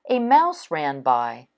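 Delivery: plain reading voice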